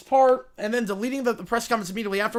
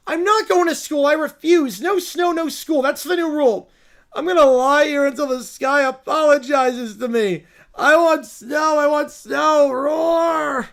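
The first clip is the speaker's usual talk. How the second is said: put-on kids' tantrum; 4 dB louder than usual. Kids throwing a tantrum over no snow, loud screaming. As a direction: throw a full brat tantrum. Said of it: "just start throwing a tantrum".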